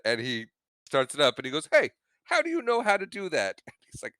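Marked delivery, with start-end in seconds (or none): 1.7-3.6 higher, startled ask; amazed question. Retold or imitated. Biff amazed the old man started his car, higher surprise. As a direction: sound caught off guard.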